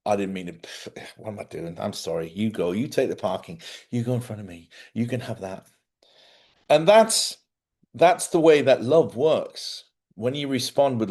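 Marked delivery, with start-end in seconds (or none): 0.0-5.7 softened apology. Retold or imitated. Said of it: "And they'll say".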